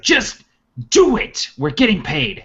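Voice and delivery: high, forceful burst of impatience; exasperated order. Acted. Mercenary leader to questioning men ordering retreat, exasperated order. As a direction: overrule them with a high-pitched shout.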